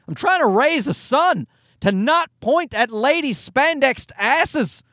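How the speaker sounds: usual speaking voice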